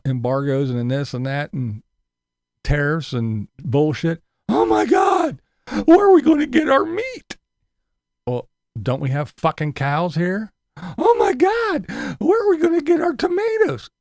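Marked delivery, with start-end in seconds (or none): 4.5-7.4 shrieking high, loud mock panic over meat. Ranter mocking tariff critics, shrill mock panic. 10.8-13.9 shrieking high, loud mock panic over meat. Ranter mocking tariff critics, shrill mock panic.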